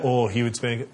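ordinary talk

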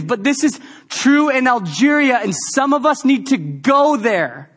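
loud, high preaching cry; preaching at full force. Acted. Pastor preaching hard to the church about Jesus, full force. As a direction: thunder it out to the congregation.

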